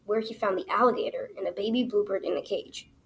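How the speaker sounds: usual speaking voice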